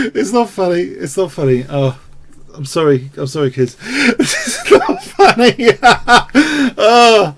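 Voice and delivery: loud, high, breaking into laughs; laughing hard. Real, not acted. Streamer apologising to kids while laughing loudly. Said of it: "I don't know why I'm laughing".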